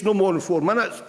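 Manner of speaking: usual speaking voice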